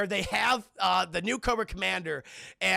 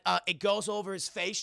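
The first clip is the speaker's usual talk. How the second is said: ordinary talk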